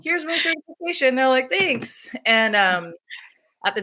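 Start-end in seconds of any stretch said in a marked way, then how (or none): 0.0-1.1 high, breezy innocent voice; mock innocent. Doula showing fake certificate to hospital, high mock innocence. Mock quote of herself, amused. 1.5-2.1 mock chirpy thanks. Hospital staff voiced, mocking.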